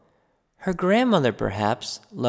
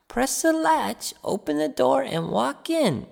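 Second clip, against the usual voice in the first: put-on old grandmother; 11 semitones higher than usual. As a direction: invite him in like a frail old granny. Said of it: "said the grandmother".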